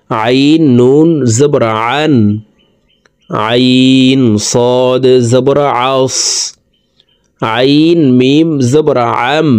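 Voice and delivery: slow drill, each "আইন" squeezed from mid-throat; pressed throat ayn. Shown as a demonstration. Teacher drilling ayn combinations for viewers, pressed throat sound. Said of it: "হলকের মধ্যখান থেকে চাপ দিয়ে উচ্চারণ করতে হবে".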